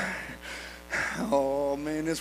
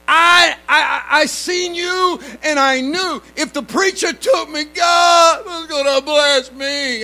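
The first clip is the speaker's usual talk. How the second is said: high, shouting, eager retelling; a man yelling that God will bless him; excited man; 9 semitones higher, 11 dB louder, slower than usual. Retold or imitated. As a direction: play the overjoyed inmate at full volume. Excited man at jail retold.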